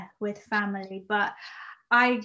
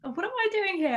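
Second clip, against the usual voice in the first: panicky inner voice; 8 semitones higher, 6 dB louder than usual. Retold or imitated. Retelling panicked thoughts before audition.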